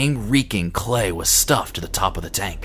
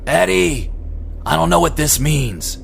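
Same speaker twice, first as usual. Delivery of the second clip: louder and higher yell; 7 semitones higher, 4 dB louder than usual. Acted. Carl yelling to Eddie over the tractor in the storm, loud and high. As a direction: call it over the wind. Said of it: "he yelled".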